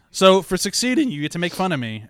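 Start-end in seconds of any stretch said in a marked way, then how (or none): 0.1-1.1 voice cracking. Real, not acted. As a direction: voice cracks on the word. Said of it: "my voice cracking there".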